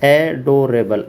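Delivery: एडोरेबल said slowly, syllables apart; teacher introducing एडोरेबल slowly; slow word demo. Shown as a demonstration. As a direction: draw out एडोरेबल for the class. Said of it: "एडोरेबल यानी मतलब".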